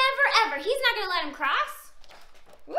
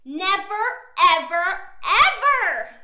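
emphatic refusal building louder; grouchy troll; 2 semitones higher, 9 dB louder than usual. A narrator's reading. Troll refusing Big Billy, grouchy and building. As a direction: build to a loud last word. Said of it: "Look at that grouchy troll".

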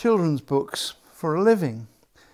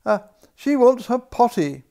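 whispered yet loud, higher cry; admiral crying out; 7 semitones higher, 7 dB louder than usual. A narrator's reading. Admiral passing on the alarm in a loud whisper. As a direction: rasp the alarm in a hoarse whisper. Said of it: "cried the admiral".

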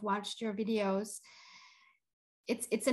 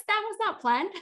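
teary high laugh; 10 semitones higher, 3 dB louder than usual. Real, not acted. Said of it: "I'm tearing up".

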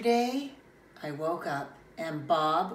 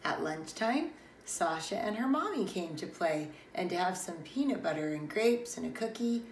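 ordinary delivery, nothing marked

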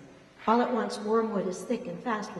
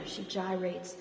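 ordinary delivery, nothing marked

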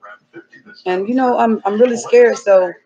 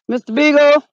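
calling out; 4 semitones higher, 5 dB louder than usual. Real, not acted. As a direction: call the dog's name long and loud. Calling out for lost dog.

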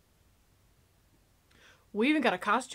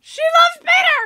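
much higher, louder excited cry; excited shout; 14 semitones higher, 9 dB louder than usual. Real, not acted. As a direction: blurt it out thrilled. Gasps, then shouts with excitement.

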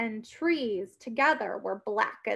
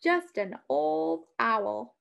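farmer voice; 4 semitones lower, slower than usual. A narrator's reading. Farmer dismissing the noise.

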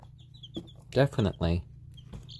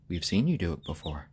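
ordinary talk, nothing marked

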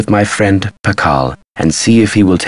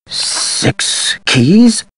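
hushed, much higher, rising question; dawning realization; 13 semitones higher than usual. Acted. Man remembering a clue about the tomb, astonished. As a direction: let the discovery creep in as a question.

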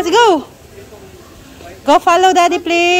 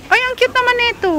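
excited; 8 semitones higher, faster than usual. Real, not acted. Delighted at cute animal.

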